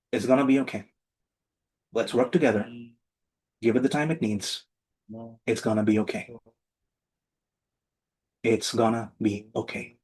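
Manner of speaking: steady them gently, no push; version three, calm. Shown as a demonstration. Calming a partner in a money dispute, gently and calmly. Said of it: "Version three".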